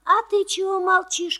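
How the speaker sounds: usual speaking voice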